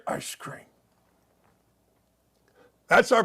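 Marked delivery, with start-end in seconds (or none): 0.1-0.7 breathy mock whisper in the ear. Wife whispering a reminder to her husband, hushed whisper. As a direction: hush it like a secret prompt. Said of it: "She had to whisper in his ear".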